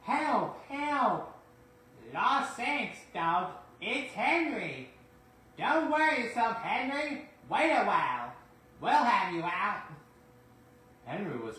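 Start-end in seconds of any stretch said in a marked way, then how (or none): 0.0-1.3 high, loud desperate call; cry for help. Acted. Henry stuck in snowdrift. 2.1-9.8 high, loud calls across the snow; calling out. Acted. Twins call to stuck Henry.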